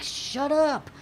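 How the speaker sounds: high, whiny comeback; mock-exasperated retort. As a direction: argue back in playful frustration. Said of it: "And I'm like".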